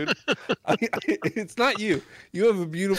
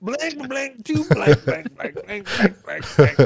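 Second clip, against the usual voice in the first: mocking the blanks; 6 semitones higher, 3 dB louder, slower than usual.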